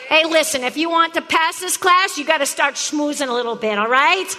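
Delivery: normal talking voice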